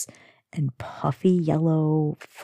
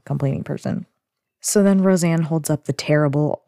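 usual speaking voice

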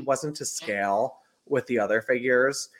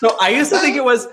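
high excited; 11 semitones higher, 8 dB louder, faster than usual. Real, not acted. Laughing at a misheard lyric.

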